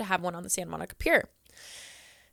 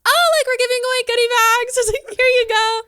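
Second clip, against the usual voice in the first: higher, louder eager giveaway voice; forced cheer; 14 semitones higher, 8 dB louder than usual. Retold or imitated. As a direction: fake brightness to hide the humiliation. Desperately giving away goodie bags.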